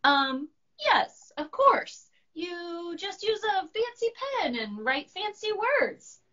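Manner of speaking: high, goofy know-it-all monster; high goofy monster bluffing; silly monster voice. Acted. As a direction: play the monster bluffing grandly. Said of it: "said Monster".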